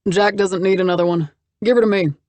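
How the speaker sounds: put-on loud, cocky young charmer; cocky young man. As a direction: cut in boldly with a grin.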